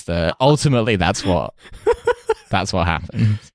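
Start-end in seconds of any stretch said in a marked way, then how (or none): none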